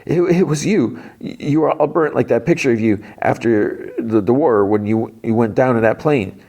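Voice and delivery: high, stammering mock-frightened boy; imitated gasping panic. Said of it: "Will's, like, gasping".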